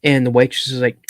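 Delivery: usual speaking voice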